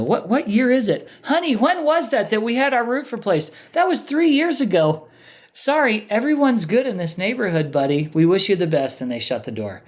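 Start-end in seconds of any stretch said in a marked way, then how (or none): 0.0-5.1 much higher, puzzled homeowner voices; a couple calling to each other about the roof; voiced homeowners. 5.5-9.0 higher, breezy brush-off; dismissive homeowner. Retold or imitated.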